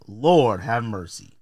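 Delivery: normal talking voice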